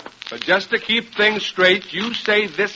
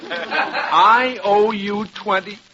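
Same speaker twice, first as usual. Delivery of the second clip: slow, higher-pitched disbelief; incredulous; 4 semitones higher, much slower than usual. Acted. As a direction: echo it slowly, dumbfounded. Billed after being told free.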